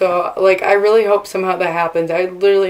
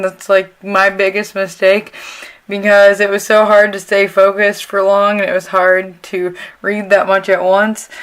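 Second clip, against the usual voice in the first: ordinary talk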